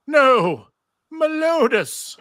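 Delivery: loud, high cry of grief and anger, name stretched slow; anguished cry. Acted. Xena finding her friend hurt, crying out in anguish. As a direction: scream his name as you find him hurt. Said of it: "react in pain and anger".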